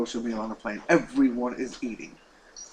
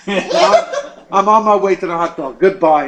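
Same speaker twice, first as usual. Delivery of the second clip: laughing, much louder and higher; mocking laughter; 5 semitones higher, 12 dB louder than usual. Professor mocks the rabbi before hanging up, loud laughing. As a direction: laugh him off and hang up.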